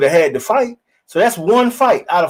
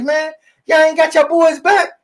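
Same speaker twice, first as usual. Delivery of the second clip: incredulous challenge; 10 semitones higher, 3 dB louder than usual. Retold or imitated. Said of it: "I'm like".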